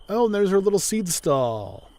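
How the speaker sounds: usual speaking voice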